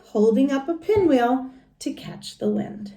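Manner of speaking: plain reading voice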